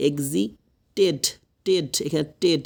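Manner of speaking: "এক্সিটেড" slowly split as ek-zi, ted; teacher sounding out the "টেড" at the end; drilling the -ted ending. Shown as a demonstration. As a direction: isolate "টেড" as its own full syllable. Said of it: "এরকম হবে না কিন্তু".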